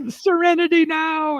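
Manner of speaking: high yelled catchphrase, fading out; Serenity now scream. Pretend. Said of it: "This is what John screams out".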